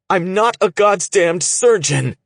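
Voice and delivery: high, loud desperate outburst; angry cry. A narrator's reading. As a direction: cry it out at her in frustration. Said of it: "Andon cried at Shay".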